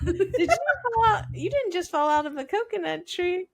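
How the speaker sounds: giggling out the famous line; high laughing mock quote; laughing through a quote. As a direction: quote it while cracking up. Quoting viral Kamala clip, laughing.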